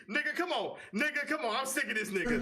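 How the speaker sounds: high mock exasperated guard; exasperated, a lightly voiced officer; a touch of the fed-up officer; imitating frustrated guard. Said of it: "he was so frustrated with me".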